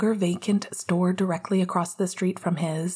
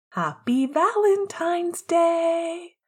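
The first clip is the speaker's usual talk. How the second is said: higher, slow, sheepishly bright exclamation; 10 semitones higher, slower than usual. Acted. James greets Sirius in his redecorated shop, sheepish high slow exclamation. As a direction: sing it out, pleased and a bit guilty. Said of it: "he exclaimed with a sheepish grin".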